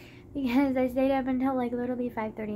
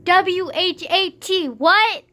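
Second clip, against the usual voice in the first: "W H A T" spelled slowly and loudly letter by letter, then "what?" rising; loud, slow spelled "W H A T" in real disbelief; 5 semitones higher, 10 dB louder, much slower than usual. Real, not acted. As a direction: punch each letter of "W H A T" then shoot "what?" up high.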